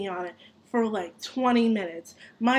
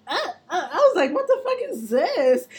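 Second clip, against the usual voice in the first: laughing disbelief; 7 semitones higher than usual. Retold or imitated. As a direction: laugh it out in shock. Shocked laughing at belt.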